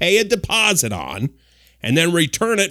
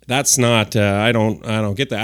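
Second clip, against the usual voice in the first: ordinary delivery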